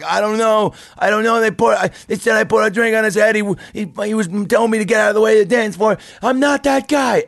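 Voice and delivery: high, loud frantic denial; desperate drunk protest. Retold or imitated. Dragged-out drunk protesting to his staring buddies, high and frantic. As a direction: plead your innocence, near hysterical.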